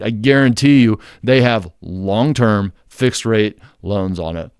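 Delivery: "long-term fixed rate" said slowly, each word leaned on; stress on long-term fixed rate. Shown as a demonstration. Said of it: "Also emphasizing long-term fixed rate loans".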